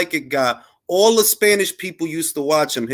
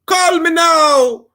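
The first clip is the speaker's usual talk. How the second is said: put-on high, slow, loud Caribbean-accented call; Miss Cleo impression; 13 semitones higher, 6 dB louder, much slower than usual. Caribbean accent, psychic hotline ad call. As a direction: belt it like a late-night psychic ad in a Caribbean accent. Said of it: "a cross between Miss Cleo".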